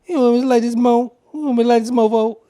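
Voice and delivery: loud, high, fast impression; cool guy voice. Repeating the line still hilarious to me, cool guy voice.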